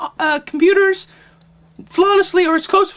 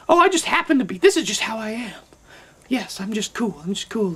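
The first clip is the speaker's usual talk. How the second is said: lower, softer smug murmur; mock aloof cool; 7 semitones lower, 5 dB quieter than usual. Cool people denying the race, a low smug murmur. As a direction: act effortlessly cool. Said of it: "They're like".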